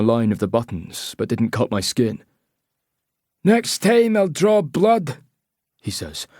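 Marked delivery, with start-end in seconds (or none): 3.4-5.2 slow, high Scottish menace; threatening Scottish accent. A narrator's reading. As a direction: warn him coldly in Scottish. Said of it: "he says in a Scottish accent".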